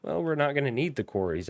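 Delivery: ordinary talk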